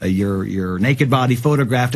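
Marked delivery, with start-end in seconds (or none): none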